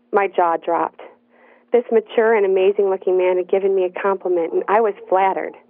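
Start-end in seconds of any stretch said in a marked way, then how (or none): none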